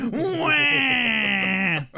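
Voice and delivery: mock crying wail. Podcaster mocks a crying son in the skit, fake wail. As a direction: bawl like a whiny kid, hammed-up.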